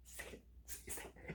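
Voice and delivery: breathless whispered urging; urgent whisper. Retold or imitated. Comic voicing the eager blonde whispering to the host. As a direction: whisper it, egging him on. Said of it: "She's like".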